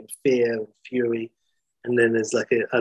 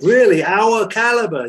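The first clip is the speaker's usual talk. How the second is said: high, loud, scoffing disbelief; outraged, astonished echo; incredulous; 9 semitones higher, 8 dB louder than usual. Acted. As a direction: throw the question back, pitched way up and loud. Freddie reacts in disbelief.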